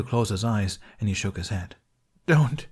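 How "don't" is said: loud, high, begging plea; pleading. Acted. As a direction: beg him to stop. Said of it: "he pleaded".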